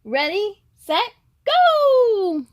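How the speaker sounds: ready, set, then shout go; race start call. Acted.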